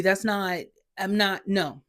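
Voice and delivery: ordinary talk, nothing marked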